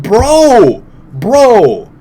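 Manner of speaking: loud, high, drawn-out exasperated call; comic loud plea to a buddy; mock protest. Acts out protest about no cover.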